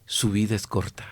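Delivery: slow, measured words with long pauses; solemn quoted pronouncement. Retold or imitated. Quoting the Pope's words to Ortega, solemn.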